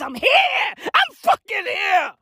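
high, strained, drawn-out yell; stretched high-pitched holler; losing voice shouting. Real, not acted. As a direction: shout it till your voice nearly goes. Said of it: "I'm fucking losing my voice".

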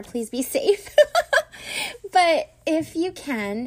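laughing through the words. Real, not acted. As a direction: break into a laugh mid-line. Laughs at driving joke.

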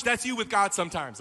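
ordinary talk, nothing marked

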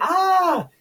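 high mock scream; pained scream. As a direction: yelp in a squeal. Boy's ear is ripped off.

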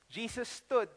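ordinary talk

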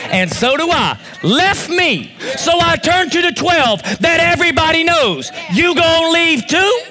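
high, shouted pulpit proclamation; fervent preacher speaking as a challenging Jesus; full-force preaching. Acted. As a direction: stretch key phrases and build to a shout.

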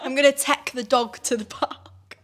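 slow, amused "tech" for take, short e; imitating boyfriend's vowels. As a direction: mimic "tech" slowly with a laugh. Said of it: "he goes, tech".

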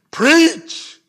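loud, high, stretched-out PREACH; forceful preaching. Shown as a demonstration. Preacher refusing to say preach lightly, forceful cry. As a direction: blast the word out from the pulpit. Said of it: "I can't say it lightly".

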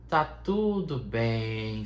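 slow, drawn-out calm mantra; mock meditation. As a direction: stretch "tudo" long and slow, easing them down. Leads a meditation. ("tá tudo bem" dito devagar, arrastando o "tudo")

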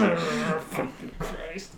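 grumbling. Retold or imitated. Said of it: "everyone grumbles the same thing".

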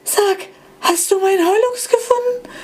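high, teary disbelieving voice; old iPod asking in teary disbelief. Acted. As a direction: ask hardly daring to hope. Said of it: "flüsterte der iPod ungläubig".

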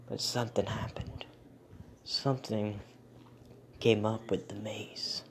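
hushed, eerie storytelling whisper; suspenseful whisper. Acted.

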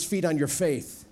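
slow solemn command; slow grave voice of God. Acted. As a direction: speak it slowly as God. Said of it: "God says".

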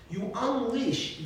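ordinary talk

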